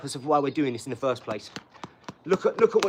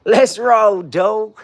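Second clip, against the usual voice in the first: loud, high holler; rallying shout; 5 semitones higher, 10 dB louder, much slower than usual. Real, not acted. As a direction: belt it out to get things moving.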